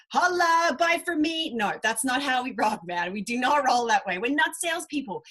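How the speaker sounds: ordinary talk, nothing marked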